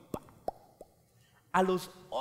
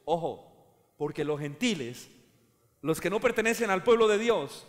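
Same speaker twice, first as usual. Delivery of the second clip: ordinary delivery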